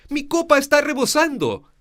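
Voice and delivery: high, loud overflowing cup; soaring high and loud; rising up high. Shown as a demonstration. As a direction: lift your voice up high with joy. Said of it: "deben subir".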